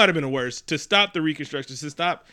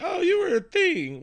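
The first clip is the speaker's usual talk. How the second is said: mock surprise; slower than usual. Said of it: "I've been seeing".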